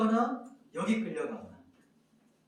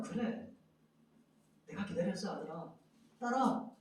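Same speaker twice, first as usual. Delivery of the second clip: gentle Jesus; 6 dB quieter than usual. Acted. Jesus answering the believer.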